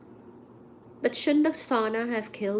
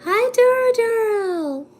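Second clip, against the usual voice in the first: sing-song greeting; 11 semitones higher, 6 dB louder, slower than usual. Acted. Greeting a viewer.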